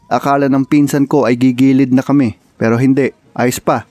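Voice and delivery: loud storytelling narration; voiceover narration. Acted. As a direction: narrate the trip like a documentary. Vlog narration, louder.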